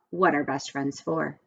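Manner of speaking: plain reading voice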